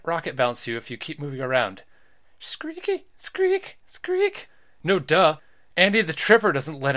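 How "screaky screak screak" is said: very high squeaky spring sound; spring squeak noise. Acted. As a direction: imitate a creaky trampoline spring.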